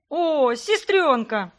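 loud, slow, drawn-out shout of joy; a delighted long-held bellow; joyful yell. Acted. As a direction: brother spotting his sister and crying out. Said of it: "возопил братик".

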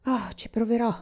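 a reluctant sigh of a promise. A narrator's reading. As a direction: sigh it out, resigned to trying. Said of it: "sospirò lei".

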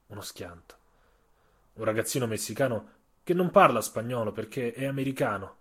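ordinary delivery

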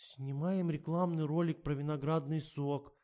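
high, small squeak; high-pitched little reply; squeaky voice. A narrator's reading. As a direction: squeak out the answer. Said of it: "пискнула Катя".

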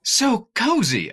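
drawn-out high, loud swoon; cozy swoon. Real, not acted. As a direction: coo it like you want to snuggle in it. Admiring Karloff's jacket.

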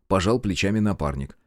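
usual speaking voice

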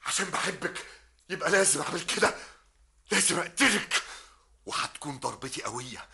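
a desperate vow that breaks upward; tormented lover. Acted. Medhat on tape, swearing to kill Sabah. Recorded man vows to kill.

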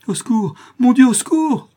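very high, loud panicked scream; terrified shriek; cry for help. A narrator's reading. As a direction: scream for rescue with all your force. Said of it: "cria madame de Fermont".

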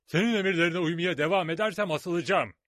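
a frightened sailor shouting back; angry pirate. A narrator's reading.

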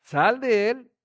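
loud stern order; loud command. Acted. Preacher repeating Jesus's command to spirit, loud. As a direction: cast it out.

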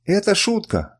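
high-pitched exclamation; crying out. A narrator's reading. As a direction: call it out high, without much extra volume. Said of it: "выкрикнул Сафрар". (высокий выкрик)

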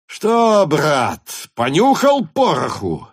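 loud, high hussar's shout. A narrator's reading. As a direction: yell it right into his ear. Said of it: "прокричал ему над ухом голос Васьки Денисова".

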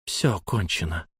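low, soft, grave statement; hushed, controlled resolve; quiet finality. A narrator's reading. Лайла повторяет ошарашенному Эльму.

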